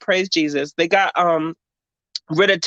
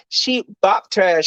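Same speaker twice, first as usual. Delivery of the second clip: normal talking voice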